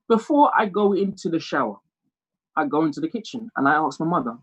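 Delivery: ordinary talk, nothing marked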